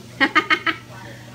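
bright burst of laughter. Real, not acted. Host laughing at her teasing joke, bright laugh. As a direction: laugh out at your own joke.

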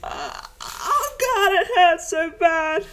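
high, drawn-out scream of pain; acted tearful scream, slow and high. A narrator's reading. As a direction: wail it loudly in agony. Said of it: "Brassard screaming".

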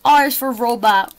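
plain reading voice

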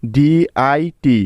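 very slow, letter by letter; each letter of DIT said slowly and separately; slow spelling. Shown as a demonstration. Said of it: "ডিট বানানটা আমরা কি পেলাম এখানে?".

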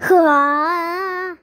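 very drawn-out groaning sigh; long weary sigh. Acted. Brother missing the PC room, long weary sigh. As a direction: let out a long, acted sigh. Bored brother stuck at home.

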